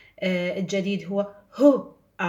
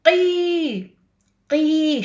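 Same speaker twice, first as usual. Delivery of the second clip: قي called out high and loud; teacher calling out a syllable; emphatic syllable; 7 semitones higher, 6 dB louder, much slower than usual. Shown as a demonstration. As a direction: belt out the syllable قي. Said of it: "صار عندنا تس".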